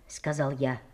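plain reading voice

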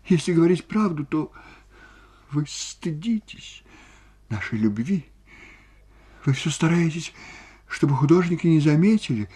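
high, halting tearful voice; high-pitched weeping complaint; sobbing reproach. A narrator's reading. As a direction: reproach through sobs, pausing between phrases. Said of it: "сказала она и зарыдала".

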